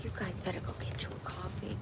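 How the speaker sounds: soft conspiratorial whisper; hushed quick whisper to viewers. Real, not acted. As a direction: lean in and whisper the tip. Secretive aside to viewers.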